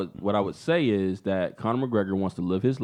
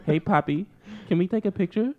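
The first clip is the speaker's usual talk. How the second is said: higher mock fangirl voice; 7 semitones higher than usual. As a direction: ask sweetly as a put-on flirty fan.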